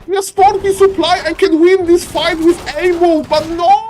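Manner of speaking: hammed-up panic; mock panicked player. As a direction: play the scared player losing it. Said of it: "He's like".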